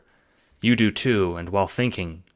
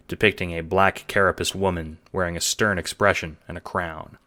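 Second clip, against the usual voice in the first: ordinary delivery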